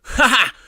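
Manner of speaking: loud, high taunting ha ha; mocking laugh. A narrator's reading. Big brother mocking laugh at the crying boy. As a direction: laugh cruelly at him.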